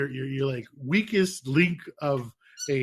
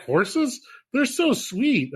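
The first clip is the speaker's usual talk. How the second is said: mock wife; 9 semitones higher, much slower than usual. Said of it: "She's like".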